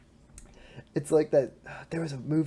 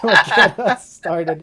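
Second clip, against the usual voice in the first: drawn out, loud, laughing; slow laughing shout; 4 semitones higher, 11 dB louder, slower than usual. Real, not acted. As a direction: stretch it out while laughing. Repeated slower with a laugh.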